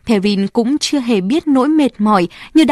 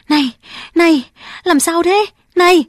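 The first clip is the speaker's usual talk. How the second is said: urgent calling; 7 semitones higher than usual. Acted. Crowd calls to injured girl.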